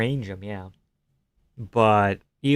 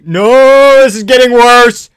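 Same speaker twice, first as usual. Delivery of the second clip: high, loud despairing cries, slow on the name; watching his pick lose; anguished shouting; 10 semitones higher, 13 dB louder, slower than usual. Real, not acted. Fighter being finished live.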